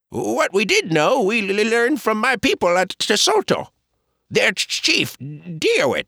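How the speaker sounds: high, stammering Caddo man; Oates's halting English. Acted. Oates explaining to Pa, high halting accented voice.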